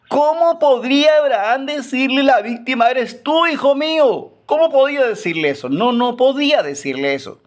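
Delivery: pitched high and pleading, voice straining with pity; anguished preaching. Acted. Preacher imagining a father's dilemma, high anguished plea. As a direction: plead with the congregation as if it hurts.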